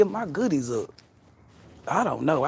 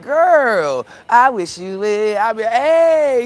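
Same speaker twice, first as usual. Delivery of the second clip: high, loud drawn-out singer's holler; hammed-up celebrity imitation, pitched way up; 8 semitones higher, 8 dB louder, much slower than usual. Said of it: "[name] be like".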